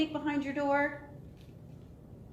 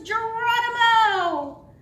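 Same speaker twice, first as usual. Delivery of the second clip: long, high, loud yell; drawn-out gleeful shout; leaping battle cry; 11 semitones higher, 12 dB louder, slower than usual. Acted. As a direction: holler it while flinging yourself off. Dog leaping in the story.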